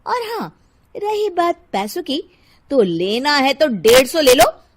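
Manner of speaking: quarrelsome, pushy housewife; bossy Shilpa. Acted. Housewife threatening the poor vegetable seller, bossy and indignant. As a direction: lay down the law to the vendor.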